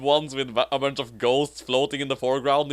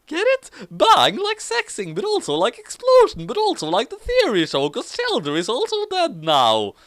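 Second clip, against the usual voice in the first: high silly voice; 12 semitones higher, 4 dB louder than usual. Acted. Character joking.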